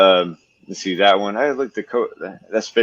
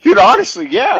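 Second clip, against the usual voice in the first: much higher, loud excited burst; eager agreement; 8 dB louder than usual. Real, not acted. Agreeing with his cohost about moody snakes, excited and high. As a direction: jump in with total agreement.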